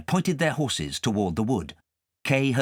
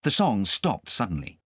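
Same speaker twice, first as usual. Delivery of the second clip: ordinary delivery, nothing marked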